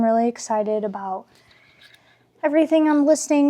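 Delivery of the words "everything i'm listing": breathless and rushed; words tumbling out short of breath. Real, not acted. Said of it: "Sorry, I'm out of breath".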